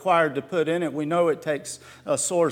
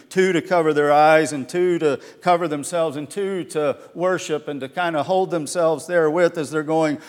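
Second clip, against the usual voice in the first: ordinary delivery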